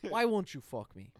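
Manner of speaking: loud, half-hearted Warburton attempt; failed deadpan impression; Patrick Warburton voice. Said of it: "I can't do the impersonation".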